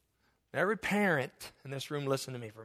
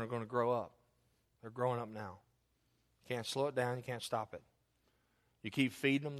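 soft, lower pastoral voice; quiet heartfelt appeal; 8 semitones lower, 8 dB quieter than usual. Real, not acted. Pastor talking softly to parents in the room, gentle appeal.

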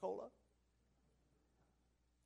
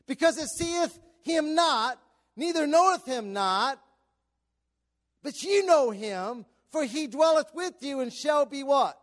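loud, raised pulpit reading; fervent scripture preaching; 8 semitones higher, 10 dB louder than usual. Real, not acted. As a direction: proclaim each phrase to the congregation.

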